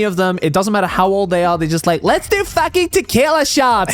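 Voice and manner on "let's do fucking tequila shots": put-on high, drawn-out Valley Girl drawl. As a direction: play a ditzy Valley Girl, slow. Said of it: "That's a Valley Girl impression".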